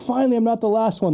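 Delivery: high-pitched, elated underdog voice; mock relief. Podcaster voicing the Coast Guard in a retold scene, mock relief. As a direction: play the little service thrilled at last.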